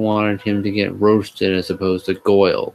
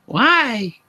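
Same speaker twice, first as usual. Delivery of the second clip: high squeaky exclamation; exclaimed. Acted. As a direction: blurt it in a falsetto girl voice. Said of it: "I exclaimed".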